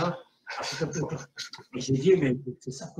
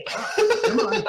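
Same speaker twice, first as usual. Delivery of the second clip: high squeal; 5 dB louder than usual. Acted. Sudden high squeal, innuendo.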